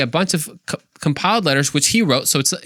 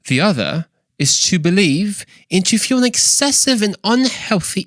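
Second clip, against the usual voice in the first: put-on British accent, pitched up; C S Lewis impersonation; 4 semitones higher than usual. Shown as a demonstration. Podcaster reads the book passage as its author, British accent. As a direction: declaim the passage in mock-British tones. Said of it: "with my best C S Lewis impersonation".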